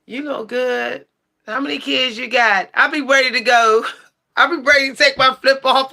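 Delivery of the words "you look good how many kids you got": imitating a guy trying to smooth-talk her; random dude hitting on me. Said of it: "some random dude come up to me and be like".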